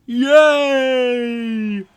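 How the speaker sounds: long, loud, high whoop; high drawn-out celebratory yell; delighted cheer. Real, not acted. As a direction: cheer it out and hold the note. Cheering the verdict.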